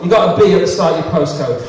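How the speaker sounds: usual speaking voice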